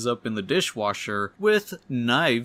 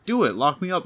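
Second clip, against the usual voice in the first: raised, higher-pitched defiant dare; mild acted yell; 6 semitones higher, 3 dB louder than usual. A narrator's reading. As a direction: dare them in a lightly raised voice. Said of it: "She yells at her".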